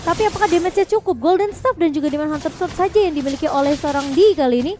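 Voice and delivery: ordinary talk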